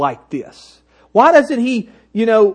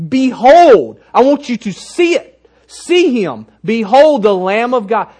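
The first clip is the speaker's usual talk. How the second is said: emphatic proclamation; 2 semitones higher, 5 dB louder than usual. Acted. John the Baptist pointing out Jesus to the crowd, emphatic proclamation. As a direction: herald it forcefully, punching the first word. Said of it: "When he says".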